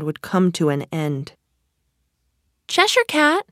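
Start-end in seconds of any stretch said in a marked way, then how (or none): 2.7-3.5 put-on high-pitched, louder girl character; Alice. A narrator's reading. Alice addressing the Cheshire Cat, high voice. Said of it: "Alice said".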